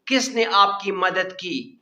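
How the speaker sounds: normal talking voice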